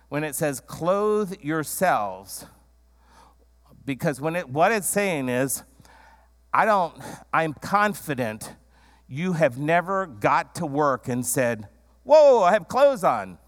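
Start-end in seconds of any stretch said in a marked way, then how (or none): 0.6-2.5 "Clothe yourselves" drawn out very slowly; stress on clothe. Shown as a demonstration. Preacher savouring the verb, very slow. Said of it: "I like this verb". 12.0-13.4 mock surprise. Imagined surprise at being dressed.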